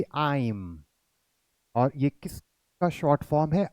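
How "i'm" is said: I'm said as one short syllable, "aim"; teacher's model of I'm; contracted I'm. Shown as a demonstration. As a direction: clip "I'm" into a single quick sound. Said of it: "इसकी pronunciation जो होगी वो क्या होगी".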